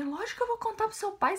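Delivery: high-pitched indignant retort; imitated indignant mother. Mother refusing to keep it from father, imitated indignation.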